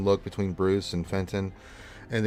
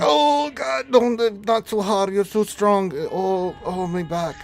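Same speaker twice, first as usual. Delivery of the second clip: high, loud squawk of protest; strained, high-pitched auntie complaint; pained old aunt; 12 semitones higher, 7 dB louder than usual. Acted. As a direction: groan and fuss as you're hugged too hard. Aunt squeezed in a hug.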